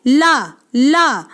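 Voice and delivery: ला drawn out slowly, with the tongue on the palate; sound demo. Shown as a demonstration. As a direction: say ला slowly and clearly for copying. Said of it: "ऐसे ला बोलेंगे".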